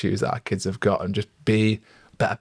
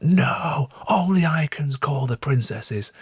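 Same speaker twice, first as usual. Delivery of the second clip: retold scream; 6 semitones higher, slower than usual. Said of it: "And she proper screamed".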